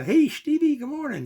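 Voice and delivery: high, bright sing-song hello; high-pitched friendly good morning; cheery greeting. Retold or imitated. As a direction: call out a happy good morning like a fond uncle. Uncle greeting him each morning.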